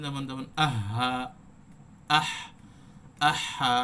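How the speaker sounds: আহা with the হ breathed out and held, slowly; আহা with an open, lingering হ. Shown as a demonstration. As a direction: let the হ in আহ run on in a long breath. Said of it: "ধরে রাখতে হবে".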